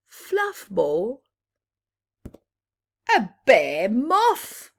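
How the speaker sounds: ordinary delivery, nothing marked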